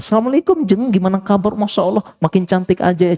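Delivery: high, gushing friendly greeting; sweet greeting. Retold or imitated. Mother greeting her friend, high and gushing. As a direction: gush over a friend in a high voice.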